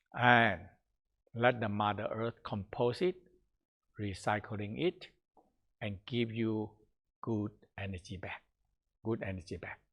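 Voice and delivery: guided meditation fading to a hush. Acted. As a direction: let the voice sink gently away.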